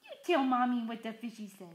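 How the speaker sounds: ordinary talk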